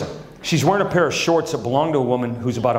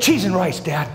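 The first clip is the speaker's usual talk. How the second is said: imitating son; 6 semitones higher than usual. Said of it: "Grayson will say it".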